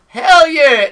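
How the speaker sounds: high, loud whoop of approval; gleeful mock cheer. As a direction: shout it like you are all for it. Imagined enthusiastic reply to the heckle.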